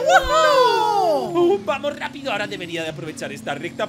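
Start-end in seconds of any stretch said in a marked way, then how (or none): 0.0-1.5 very loud, high woohoo; triumphant whoop. Acted. Racer celebrates an epic jump, jubilant whoop. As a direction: let out a long victory whoop.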